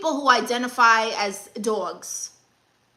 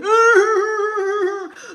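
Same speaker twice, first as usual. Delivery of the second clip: high cackling burst of laughter; loud laugh; 9 semitones higher, 6 dB louder than usual. Real, not acted. Reacting to a viewer comment, loud high cackle.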